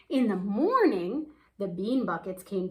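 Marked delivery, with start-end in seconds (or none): none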